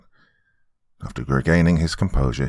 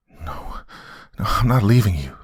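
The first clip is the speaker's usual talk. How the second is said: crying out; 3 semitones higher than usual. A narrator's reading. Wife refusing to leave husband, upset. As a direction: refuse with a touch of distress. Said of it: "she cried".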